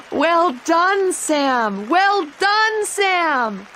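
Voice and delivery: high, loud cheering; joyful shout. A narrator's reading. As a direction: shout your pride over the noise. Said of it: "she shouted".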